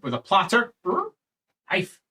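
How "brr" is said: dog growl. Acted. GM voices the dog.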